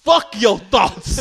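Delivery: loud, high, slow yell; preacher yell. Retold or imitated. Preacher telling the girl off, loud and slow. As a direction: bellow it like the crazy preacher. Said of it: "That's what he told the girl".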